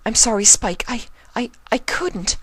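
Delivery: high, halting stammer. Acted. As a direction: stumble over the words, scared. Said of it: "Twilight stammered".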